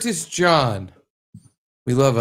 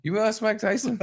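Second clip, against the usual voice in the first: mock shock; 10 semitones higher, 3 dB quieter than usual. Mock accusing his co-host.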